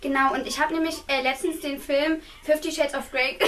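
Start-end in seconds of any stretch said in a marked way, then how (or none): none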